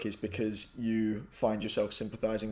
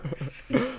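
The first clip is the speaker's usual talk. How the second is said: laughter; 14 semitones higher than usual. Real, not acted. Laughing at the French attempt.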